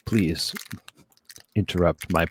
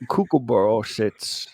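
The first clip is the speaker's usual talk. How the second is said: nursery rhyme in hammed-up Aussie; Australian-accented recitation; 5 semitones higher than usual. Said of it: "allow me to recite".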